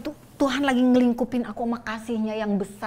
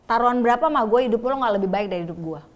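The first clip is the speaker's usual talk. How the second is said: louder, laughing challenge thrown back; louder retold comeback to the man in a suit, with laughs; retold defiant retort; 2 semitones higher, 7 dB louder than usual. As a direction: snap back at him half laughing, daring him. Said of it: "aku bilang".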